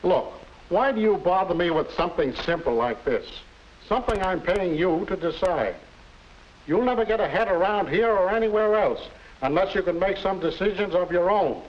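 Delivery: scolding boss. Acted.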